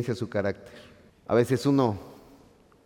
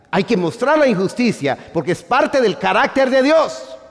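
louder, higher preaching cadence; full-force preaching; 7 semitones higher, 7 dB louder than usual. Acted. Preacher to congregation, full-force preaching. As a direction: preach it out over the pews.

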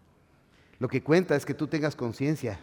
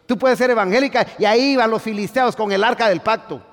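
high, loud preaching; fiery preaching; 9 semitones higher, 7 dB louder than usual. Acted. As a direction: preach it at full volume to the church. Preaching at full force.

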